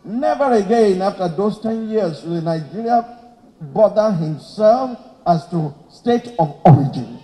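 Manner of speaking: ordinary talk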